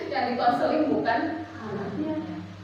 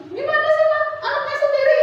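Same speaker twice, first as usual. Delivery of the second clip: much higher and louder, scolding the mother; exasperated rebuke; 13 semitones higher, 9 dB louder than usual. Shown as a demonstration. How not to talk to a mother, a loud, high scold. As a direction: snap at the parent in frustration. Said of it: "Yang penting jangan bilang".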